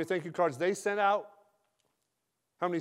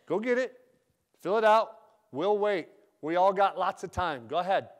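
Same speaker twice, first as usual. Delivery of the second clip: joking but ticked; 6 dB louder than usual. Retold or imitated. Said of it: "I say it like that. It's kinda funny".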